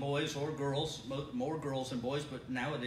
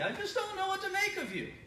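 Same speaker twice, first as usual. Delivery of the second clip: much higher, laughing exasperated father; fatherly exasperation. Retold or imitated. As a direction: throw up your hands like a bemused dad. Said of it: "just fatherly".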